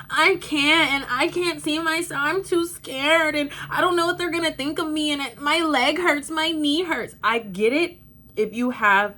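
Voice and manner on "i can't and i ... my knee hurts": high-pitched mimicry of an excuse-maker; mocking loser language. Mocking a person who uses loser language, high whiny imitation. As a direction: pitch it up and whine through the excuses. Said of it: "a person that uses loser language".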